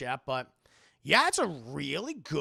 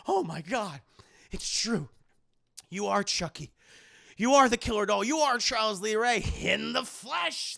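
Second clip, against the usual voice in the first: louder, rising awe; shocked realization; 5 semitones higher, 6 dB louder than usual. Retold or imitated. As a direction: gasp out the discovery. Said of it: "He goes".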